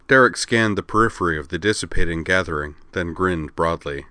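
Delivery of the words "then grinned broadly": plain reading voice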